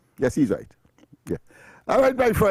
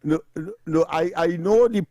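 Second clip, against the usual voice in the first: ordinary delivery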